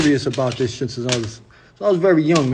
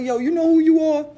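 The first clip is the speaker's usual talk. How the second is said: much higher, teasing mentor voice; mentor's mocking voice; 13 semitones higher than usual. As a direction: pitch way up to play the roasting mentor. Mentor roasting him, voiced high.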